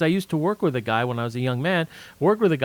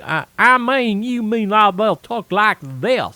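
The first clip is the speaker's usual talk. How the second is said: loud, slow, high hillbilly drawl; hillbilly accent; 4 semitones higher, 6 dB louder, slower than usual. Shown as a demonstration. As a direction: exaggerate a flat-out hillbilly twang. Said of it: "hear the flat out hillbilly".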